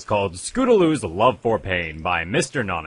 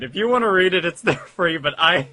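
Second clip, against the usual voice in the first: laughing through words; 9 semitones higher, 5 dB louder than usual. Real, not acted. Still laughing, giving up.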